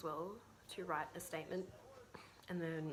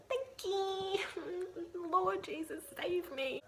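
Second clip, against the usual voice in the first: much higher, louder hammed-up panic; half-laughing mock terror, pitched way up; 13 semitones higher, 4 dB louder, slower than usual. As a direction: squeal out a comic plea for rescue. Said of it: "I was like".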